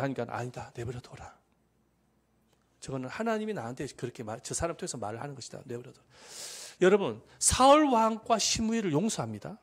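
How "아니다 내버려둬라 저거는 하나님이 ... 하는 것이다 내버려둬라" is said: low, hushed acted reply; quiet restraint. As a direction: voice a king softly calling off his aide. David voiced restraining his aide.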